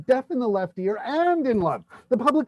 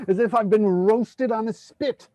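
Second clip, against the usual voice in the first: normal talking voice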